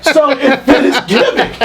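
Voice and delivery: chuckling through the words; laughing. Real, not acted. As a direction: laugh as you say it. Laughing while telling.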